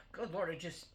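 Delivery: ordinary talk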